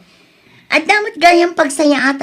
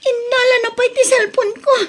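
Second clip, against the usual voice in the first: tearful lament; 11 semitones higher than usual. Acted. Locked in, phone taken.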